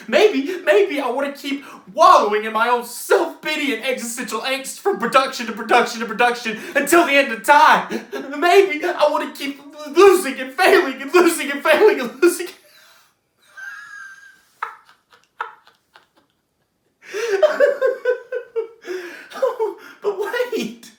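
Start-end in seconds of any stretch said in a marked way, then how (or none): 0.1-12.5 loud, rushed, climbing tirade; frantic self-pitying rant. Acted. Character spiralling about endless productions, frantic self-pitying rant. 17.1-20.0 high, drawn-out laughing fit; unravelling laugh. Acted. Character breaking down after his rant, unravelling laugh.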